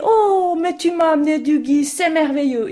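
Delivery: overjoyed at the gift; delighted mother. Acted. Mother greeting the girl with mistletoe, delighted. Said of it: "Elle dit".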